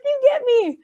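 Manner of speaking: teary gushing. Retold or imitated. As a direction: squeal it, moved. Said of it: "I was like".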